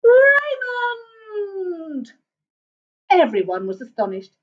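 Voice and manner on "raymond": long, high mother's call; high drawn-out shout for a lost child; loud shout. A narrator's reading. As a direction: holler the name, stretched out. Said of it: "shouted out loudly".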